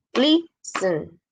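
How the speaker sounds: listen in two drawn-out beats, LIS on top; syllable-by-syllable LIS-ten, first one stronger; stress on first syllable. Shown as a demonstration. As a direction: separate lis and sen, dropping on sen. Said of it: "como que es más alto".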